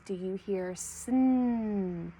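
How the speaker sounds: "sn" drawn out slowly and leaned on; stressing the blend. Shown as a demonstration. Teacher asking children to listen for "sn", slow stress. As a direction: linger on "sn" so children catch it. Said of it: "Listen for our blends".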